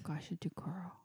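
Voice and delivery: "Gashadokoro" breathed in an eerie whisper. Pretend. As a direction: whisper "Gashadokoro" like a ghost story. Creepy whispered repeat of name.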